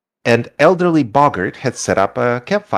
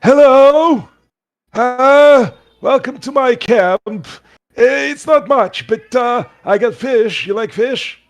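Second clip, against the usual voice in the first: put-on high, loud, folksy old-timer voice; squeaky cheerful old boggart greeting travellers; 12 semitones higher, 4 dB louder, slower than usual. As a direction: play the friendly old fisherman by the fire. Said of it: "kinda looks at you and goes".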